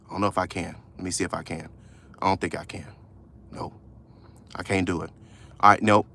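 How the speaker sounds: very low, soft self-talk; distracted low murmur while typing; muttering to oneself. Real, not acted. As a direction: think aloud under your breath. Talking to self while trying to type.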